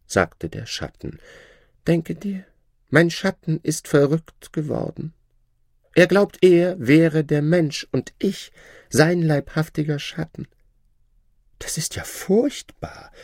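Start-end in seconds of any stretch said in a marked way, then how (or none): 1.9-10.5 high, lordly and condescending; haughty shadow. Acted. The Shadow lording it over the learned man, haughty high voice. 11.6-13.1 high, breathless whispered gasp; horrified whisper. Acted. The princess hearing the shadow went mad, horrified high whisper. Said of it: "rief die Prinzessin".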